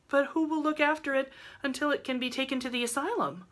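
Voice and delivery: put-on high-pitched, insistent girl; persistent. A narrator's reading. Rilla asks who will mind the baby, persistent. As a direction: press the question in a high voice. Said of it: "persisted Rilla".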